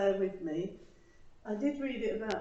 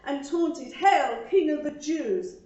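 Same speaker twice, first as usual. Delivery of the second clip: earnest, measured poem reading with pauses; poem recital; 4 semitones higher, 4 dB louder than usual. Acted. Reading her poem to the congregation, earnest recital. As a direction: recite it like a heartfelt church poem.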